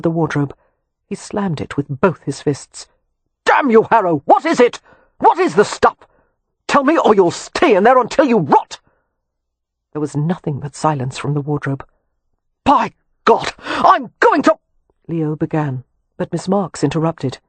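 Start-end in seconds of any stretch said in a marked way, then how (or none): 3.4-8.8 high, loud furious yelling; furious shout. A narrator's reading. Leo threatens man in wardrobe. 12.6-14.6 high, loud and slow, cut off mid-threat; furious outburst. A narrator's reading. Leo's threat is interrupted.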